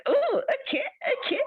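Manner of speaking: high-pitched little "oh, okay"; surprised acceptance. Retold or imitated. Guest hearing the plan moved to his house, surprised acceptance. Her reaction to changed Christmas plan.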